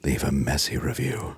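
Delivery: low, soft seductive voice; sultry narrator. Pretend. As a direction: purr it low into the mic. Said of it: "sultry voices in your ear like this".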